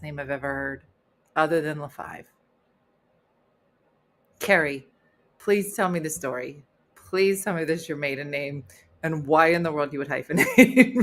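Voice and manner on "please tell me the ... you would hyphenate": ordinary delivery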